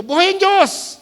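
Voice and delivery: buhay ang Diyos! cried out high and loud; a preacher's ringing declaration; proclaimed. Acted. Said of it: "Isa lang sinasabi ko".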